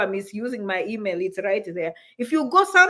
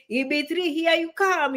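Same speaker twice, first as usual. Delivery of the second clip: normal talking voice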